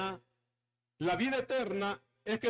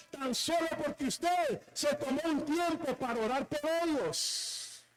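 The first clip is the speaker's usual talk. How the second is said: fervent preaching; 5 semitones higher than usual. Acted. Preacher urging the congregation to pray, fervent. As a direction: preach it up to a shout.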